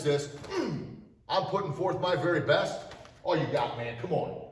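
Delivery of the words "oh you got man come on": ordinary talk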